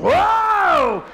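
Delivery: huge high drawn-out "whoa"; wife's labor yell. Retold or imitated. Husband retells his wife's first labor pain, huge high yell. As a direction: howl it out at full volume. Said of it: "My wife's in the back seat going".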